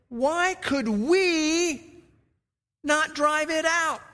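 high, slow, bewildered question; lightly voiced baffled disciples; puzzled disciples. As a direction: ask it like confused disciples who failed. Disciples asking Jesus, quoted in sermon.

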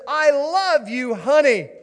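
loud, drawn-out romantic announcement; grand love declaration. Acted. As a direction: proclaim it slowly and loudly, like a hammed-up suitor. Husband proudly presents flowers and card.

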